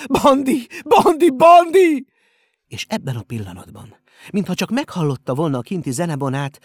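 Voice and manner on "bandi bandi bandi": repeated high, frantic calls; desperate, very loud yelling; crying out. A narrator's reading. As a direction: shout the name over and over, rising. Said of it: "kiáltotta".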